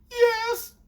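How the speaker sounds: very high mimicked yes; cornered girlfriend. Girlfriend answering his accusation, imitated high-pitched. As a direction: squeak out the admission.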